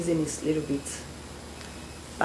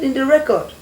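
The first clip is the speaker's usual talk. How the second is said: much higher and louder, a hammed-up mocking question; mock British man; 9 semitones higher, 9 dB louder than usual. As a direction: play the teasing foreigner, pitched up high. Retold British man mocking her.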